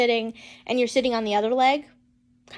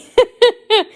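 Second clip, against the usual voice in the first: high burst of laughter; 11 semitones higher, 10 dB louder than usual. Real, not acted. Laughs after saying will cry.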